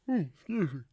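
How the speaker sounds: high yawning hum before excuse me. Real, not acted. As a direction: yawn through it. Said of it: "yawning in y'all ear again".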